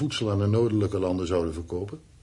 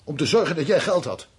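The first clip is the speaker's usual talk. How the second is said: louder, higher, hurt and defensive; angry retort; 6 semitones higher, 7 dB louder than usual. Acted. Husband snapping back at his wife in the quarrel, angry.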